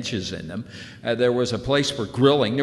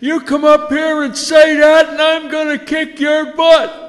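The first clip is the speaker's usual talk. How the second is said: much higher and louder, yelling down from the pier; furious shout; 10 dB louder than usual. Retold or imitated. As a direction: bellow a threat at the kid in the water. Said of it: "and he's yelling".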